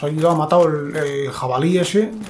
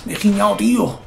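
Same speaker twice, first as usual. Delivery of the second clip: startled; 6 semitones higher than usual. Real, not acted. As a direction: yelp from a sudden scare. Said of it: "qué susto me ha dado". (sobresalto, agudo y fuerte)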